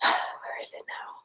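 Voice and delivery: a sighing whisper to herself; whispered aside. Real, not acted. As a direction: breathe it out under your breath. Muttered aside while searching phone.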